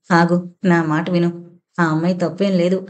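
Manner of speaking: ordinary delivery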